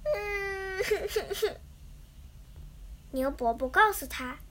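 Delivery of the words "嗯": soft whimper breaking into little sobs; mock crying. A narrator's reading. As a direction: act out a sad little mouse sobbing. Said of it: "伤心地哭了".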